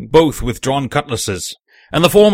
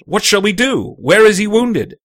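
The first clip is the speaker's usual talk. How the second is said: high, worried appeal; raised pitch, anxious questioning; anxious cry; 6 semitones higher, 3 dB louder than usual. Acted. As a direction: appeal to the doctor for help. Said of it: "we cried".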